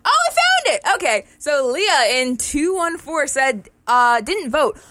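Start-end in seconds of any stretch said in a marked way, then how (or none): 0.0-0.8 sudden high relieved exclamation; surprised. Real, not acted. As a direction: blurt it out, delighted. Finds lost paper, sudden high pitch.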